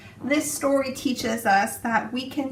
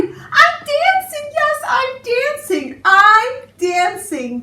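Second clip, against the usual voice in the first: much higher, louder, drawn-out cries of joy; joyful cry; 12 semitones higher, 9 dB louder, much slower than usual. Acted. Gerald discovering he can dance at last, joyful cry. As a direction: shout it out in pure delight. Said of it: "Gerald cried".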